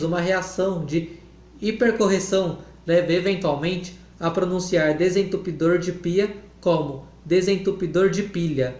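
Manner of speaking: usual speaking voice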